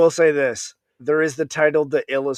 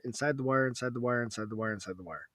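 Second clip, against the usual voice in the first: fading echo; 2 semitones lower, 11 dB quieter, faster than usual. Acted. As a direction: let it fade away like an echo. Repetition fading out.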